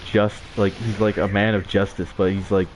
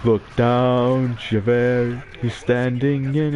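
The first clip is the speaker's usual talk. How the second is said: a slightly higher, lightly voiced pleading Valjean, slowing at the end; half-sung Les Mis plea, slowing; imitating Valjean; 3 semitones higher, much slower than usual. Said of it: "And he's like".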